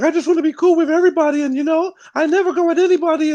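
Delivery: high mock-innocent voice of a friend. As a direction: mimic her, high and sweet. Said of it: "she's like".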